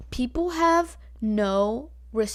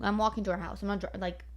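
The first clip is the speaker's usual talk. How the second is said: normal talking voice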